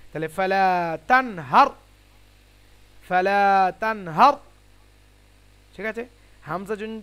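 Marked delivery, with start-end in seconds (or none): 0.4-1.7 ফালা with long "aa", then তানহার loud with heavy "r"; thick ra. Shown as a demonstration. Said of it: "রকে মোটা করে পড়তে হয়". 3.1-4.3 loud model recitation of ফালা তানহার, heavy final "r"; thick ra. Shown as a demonstration. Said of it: "রকে মোটা করে পড়তে হয়".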